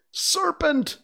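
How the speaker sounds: shrill high-pitched scream; very high, loud accusing cry. A narrator's reading. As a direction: scream it like an outraged pigeon. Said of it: "screamed the pigeon".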